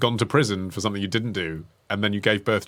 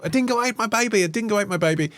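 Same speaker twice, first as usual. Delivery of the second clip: high-pitched mock-hysterical Aussie accent; dodgy Aussie accent; 9 semitones higher, faster than usual. Podcaster imitating a film actress's line, dodgy Aussie accent, a bit hysterical. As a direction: imitate a slightly hysterical Australian mother. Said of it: "slightly dodgy Aussie accent and she's a bit hysterical".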